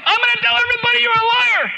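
high full-throated yell; angry yell at the top of the pitch range. Retold or imitated. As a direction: shout the accusation at an unseen enemy. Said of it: "I'm yelling".

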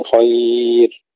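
خير very slow with a light r at the stop; long-held خير, light ر; thin final ra. Shown as a demonstration. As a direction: draw out خير and end on a thin ر. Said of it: "ترقيق الراء وقفا".

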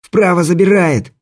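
high urgent warning shout. Acted. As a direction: yell the warning across. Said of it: "крикнул Красько".

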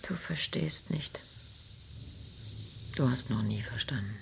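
soft, tired whisper; weary whisper. Acted. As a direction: breathe it out in resignation. Wife answers husband bitterly. (müde und resigniert geflüstert)

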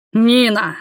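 disapproving one-word summons; displeased. A narrator's reading. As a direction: call the name in reproach. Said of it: "Недовольный голос раздался от двери".

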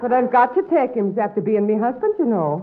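stage-Irish brogue, coaxing; put-on Irish accent. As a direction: sweet-talk him in an Irish lilt. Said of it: "picked up that accent".

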